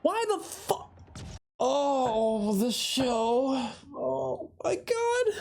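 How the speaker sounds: high, drawn-out cries of disbelief, close to tears; real shock, the voice cracking upward; stunned shock. Real, not acted. Reacting to a character's death.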